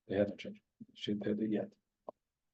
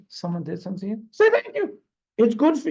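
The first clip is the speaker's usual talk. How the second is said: urging teacher voice; 8 semitones higher, 4 dB louder than usual. Retold or imitated. Acts out Talmud's urging voice.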